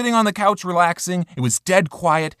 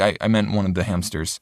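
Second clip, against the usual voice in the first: usual speaking voice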